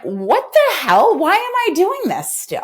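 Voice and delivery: realizing the diet cycle makes no sense, mock high exasperation. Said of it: "Rather than".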